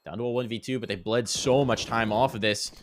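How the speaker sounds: ordinary delivery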